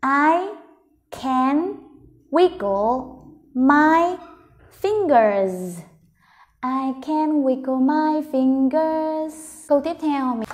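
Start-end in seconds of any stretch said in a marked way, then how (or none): 0.0-5.8 "I... can... wiggle... my... fingers", very slow with pauses; word by word. Shown as a demonstration. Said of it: "mình vào đọc từng từ một". 6.6-9.3 "I can wiggle my fingers" slow but in one connected run; joined-up slow reading. Shown as a demonstration. Teacher rereads the sentence joined up.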